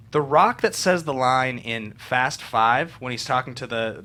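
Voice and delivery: ordinary delivery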